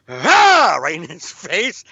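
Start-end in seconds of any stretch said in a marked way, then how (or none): 0.1-0.8 sudden high loud "Ah!"; scare yell. Retold or imitated. Dad scaring son in the car, sudden yell. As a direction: blast it right in his face. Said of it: "out of nowhere, was like".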